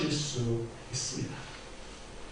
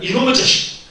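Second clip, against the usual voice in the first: high, sharp scolding cry; sharp mock scolding, pitched high; scolding rebuke; 9 semitones higher than usual. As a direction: rebuke a naughty child. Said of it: "아이를 꾸짖을 때, 비난할 때 쓰는 말입니다".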